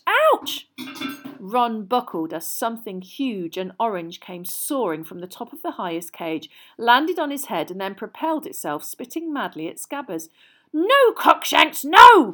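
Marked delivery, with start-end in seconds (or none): 0.1-0.7 sudden much higher loud yelp; ouch. Acted. Ron hit on the head by the cat, a loud high yelp. 10.7-12.3 much higher, louder cry; cried out. Acted. The witch crying at the cat, high and loud. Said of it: "cried the witch".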